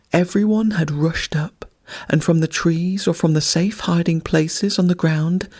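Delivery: ordinary delivery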